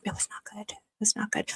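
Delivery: hushed whisper, almost voiceless. Real, not acted. Streamer confiding to viewers about sweating, whispered. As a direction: breathe it out like a secret.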